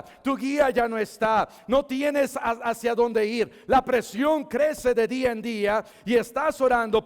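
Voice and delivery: loud, high-pitched sermon delivery; impassioned preaching. Real, not acted. Preacher addresses the desperate in the congregation, full-force preaching. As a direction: build a driving list, urgent and forceful.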